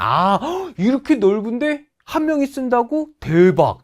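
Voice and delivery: gasp, then high incredulous question, punched last word; mock astonishment. Teacher acts shock at a room for one, mock astonishment. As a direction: act stunned with a hammed-up gasp.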